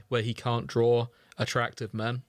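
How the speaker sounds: usual speaking voice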